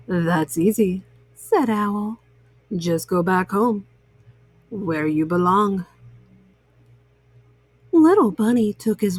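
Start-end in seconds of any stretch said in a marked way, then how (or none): 0.1-5.8 put-on deep, wise old owl; a low, knowing owl voice. A narrator's reading.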